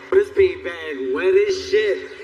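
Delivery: slow, heavy mock come-on; hammed-up dirty talk. Messed up man addresses a beanbag, in slow mock seduction. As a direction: drag it out with swagger.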